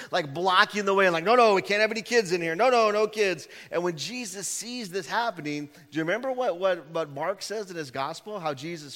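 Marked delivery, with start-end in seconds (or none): 1.2-3.5 loud, fast, higher mock bouncer; bossy guards. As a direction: shoo the kids away like bodyguards. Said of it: "lined up like the Secret Service".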